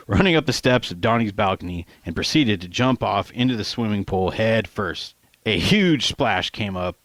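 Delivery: ordinary delivery, nothing marked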